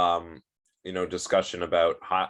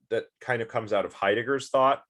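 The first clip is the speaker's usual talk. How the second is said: ordinary talk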